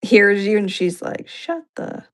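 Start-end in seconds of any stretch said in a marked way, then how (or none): 1.3-2.1 soft voiceless mock shout; hushed retold retort, barely audible; imitated call from another room. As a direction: whisper it like a faint reply from afar. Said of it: "she'll be back in her room".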